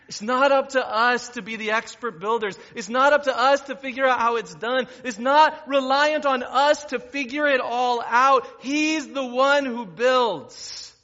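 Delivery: loud, high, driving sermon delivery; full-force preaching. Real, not acted. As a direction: preach it out to the whole room.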